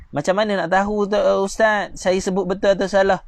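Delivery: ordinary delivery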